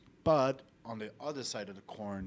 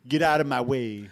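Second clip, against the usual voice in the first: louder, brusque put-on order; mock rude New Yorker; 6 dB louder than usual. Imitating rude New Yorkers shoving past, brusque. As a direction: act out a pushy passer-by.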